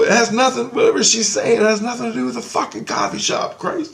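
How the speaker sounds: high-pitched, exasperated protest; retold yelling. Dreamer complains to Grace about the boss, retold yelling. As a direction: re-enact an outraged high yell. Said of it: "I'm yelling at Grace".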